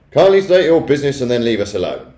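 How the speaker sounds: haughty and impatient; curt dismissal. A narrator's reading. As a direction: dismiss them like an unwanted salesman. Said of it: "spoke as though he was addressing someone".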